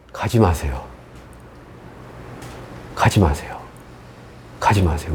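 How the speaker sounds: very low, soft pleading; retold tearful plea. Preacher retelling begging Jesus to stay, soft imitated pleading. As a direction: imitate a hushed, helpless plea. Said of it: "막 울면서 그 얘기하고 있고".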